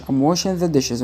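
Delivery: quick, run together; fast reading. Shown as a demonstration. Teacher showing how it sounds read fast, quick. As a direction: rattle it off like a native. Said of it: "وأنت بتقرأ بسرعة".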